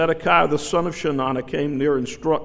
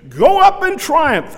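much higher, louder and slower, mocking; sarcastic; 13 semitones higher, 9 dB louder, slower than usual. Micaiah mocking the king with the false prophets' words. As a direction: sneer it back like a false prophet. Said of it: "I see Micaiah say it like that".

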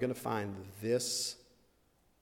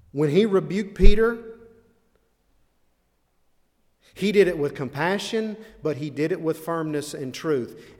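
high and much louder, then easing; forceful preaching; 5 semitones higher, 10 dB louder than usual. Acted. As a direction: drive the point home from the pulpit.

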